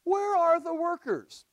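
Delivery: high, slow, acted appeal; exasperated plea. Jesus facing opposition with few helpers, exasperated plea. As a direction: stretch the question out in exasperation.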